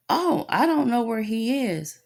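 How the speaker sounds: high-pitched mimicked teacher; clueless teacher. Teacher answering a parent about a missing child, mock clueless. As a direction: imitate a teacher with no idea, voice lifted high.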